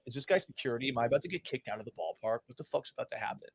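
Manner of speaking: low, soft, hurried aside; quiet, quick muttered questions to yourself; hushed inner worry. Retold or imitated. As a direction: retell a worried thought under your breath. Retold worried thought in press box.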